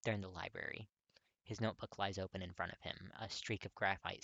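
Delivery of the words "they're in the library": plain reading voice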